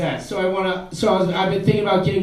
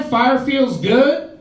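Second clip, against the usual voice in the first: slow, high "Fire feels good?" mocking it; mock incredulous; 5 semitones higher, much slower than usual. Woman repeating his line back slowly, mocking. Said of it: "she responded with".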